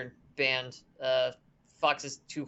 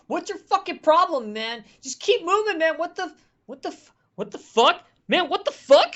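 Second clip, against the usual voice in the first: high, loud, furious yelling at a stranger; angry shout; 13 semitones higher, 9 dB louder than usual. Acted. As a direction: yell it at him like he's crazy. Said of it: "looks at you and says".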